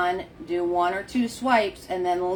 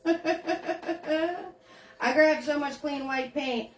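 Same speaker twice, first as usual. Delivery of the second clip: laughing; 6 semitones higher, 4 dB quieter, much slower than usual. Real, not acted. Laughing while talking.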